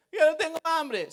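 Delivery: put-on whiny child=83. Retold child plea.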